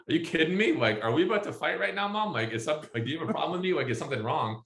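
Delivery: high-pitched, incredulous questioning; offended son's rising-pitched protest; mock indignation. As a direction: pick a playful fight with mom. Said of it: "And I'm just like".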